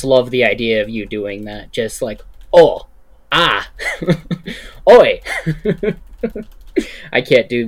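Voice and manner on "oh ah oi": mock pain grunts. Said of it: "voicing out how they were in pain".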